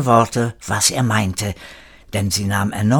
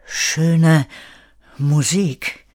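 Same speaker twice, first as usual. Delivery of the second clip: two words set apart by a pause, a touch higher; tentative venture; 4 semitones higher, much slower than usual. A narrator's reading. Kire dares two words to the guard, tentative. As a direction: venture each word separately, mildly tentative. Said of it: "wagte, zwei Worte zu sagen".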